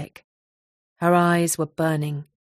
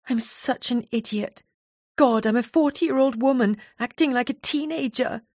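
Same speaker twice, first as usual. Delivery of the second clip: higher-pitched, mildly upset lament; tearful self-reproach; 7 semitones higher than usual. A narrator's reading. Nia, found sobbing by her friend, mildly tearful and higher. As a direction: berate yourself with a touch of tears. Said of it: "Nia was sitting sobbing quietly".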